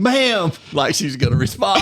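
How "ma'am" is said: louder, higher call of ma'am. Retold or imitated. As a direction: call out as if to wake her. Calling to the slumped woman.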